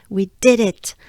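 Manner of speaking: normal talking voice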